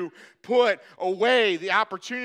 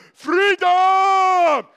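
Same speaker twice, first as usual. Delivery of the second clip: roaring battle cry, high and drawn out; long, high, full-throated yell; William Wallace; 9 semitones higher, 8 dB louder than usual. Pretend. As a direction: bellow it like a warrior leading a charge. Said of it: "with your best William Wallace voice".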